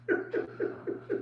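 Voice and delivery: high silent wheezing laugh; breathless laugh. Real, not acted. Laughing at his own Jack story, breathless. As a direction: laugh with almost no voice.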